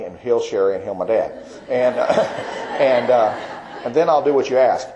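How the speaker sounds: real laughter breaking into the words; laughing. Real, not acted. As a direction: laugh through the end of the prayer. Laughs while retelling his bargain prayer.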